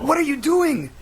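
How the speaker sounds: high, frightened question; panicked cry. Acted. Mister Gable panicking as the showman grabs him, afraid. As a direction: cry out in sudden fear.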